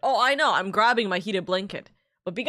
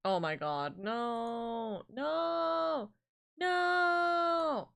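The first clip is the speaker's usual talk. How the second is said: rising panicked no; 5 semitones higher, 5 dB quieter, much slower than usual. Real, not acted. Mouth burning from hot spice.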